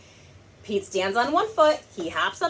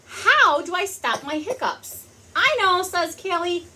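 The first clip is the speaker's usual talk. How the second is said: higher, bright storybook character voices; 8 semitones higher than usual. Acted. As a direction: play the characters up high for kids. Pete and Callie voiced.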